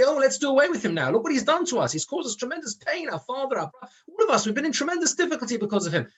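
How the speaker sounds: high, fast, hammed-up plotting brothers; a rushed, high mock conspiracy among brothers; scheming brothers. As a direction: rattle it off high like the brothers egging each other on. Brothers plotting against Yosef, voiced.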